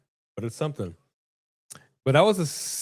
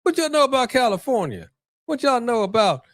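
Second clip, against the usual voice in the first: mock tough local; 13 semitones higher than usual. Brother at the gas station challenging the Californians, brash mock voice. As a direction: mimic the guy sizing you up, pitched way up. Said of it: "It's like".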